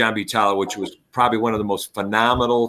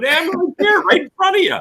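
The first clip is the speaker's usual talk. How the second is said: put-on very high, louder kid voice; kids' voices; 3 dB louder than usual. Students tell the embarrassed coach they're right in front of him, high kid voice. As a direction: sound like a student calling out from the front row.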